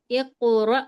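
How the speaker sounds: اقرأ with the qaf jolted and echoed; qalqala jolt. Shown as a demonstration. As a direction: give the qaf in اقرأ a sharp double sound. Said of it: "تو ہم اس کو جھٹکا دے کر پڑھیں گے".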